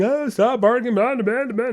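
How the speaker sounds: high, loud nagging yell; hammed-up yelling at the dog; mock screaming owner. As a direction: play the owner shrieking at the dog. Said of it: "don't go into the front room by the front door screaming".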